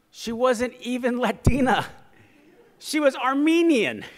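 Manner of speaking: high, loud, drawn-out proclamation; loud punchline. Real, not acted. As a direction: belt out the reveal to the crowd.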